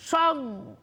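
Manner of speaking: সং said alone and halted on the nasal; stopped syllable. Shown as a demonstration. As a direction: close off the throat after সং. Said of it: "থেমে যেতে হচ্ছে".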